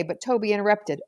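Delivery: plain reading voice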